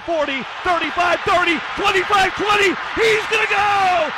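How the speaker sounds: building to a high, loud shout; mounting breakaway call. Real, not acted. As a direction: count down the yards with rising thrill. Calling a breakaway run.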